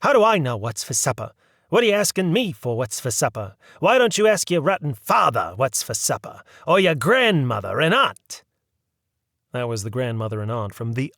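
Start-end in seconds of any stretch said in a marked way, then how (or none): 0.0-8.4 a touch of a harried mother's yell; mildly louder scolding mother. A narrator's reading. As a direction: snap at the boy, fed up. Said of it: "would she yell at him".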